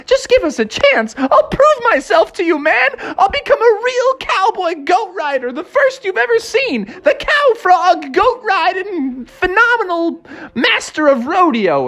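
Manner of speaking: very high, eager pleading with laughter; a squeaky frog hyping himself up. Acted. Froggy begs the rodeo clerk for a chance, very high eager voice.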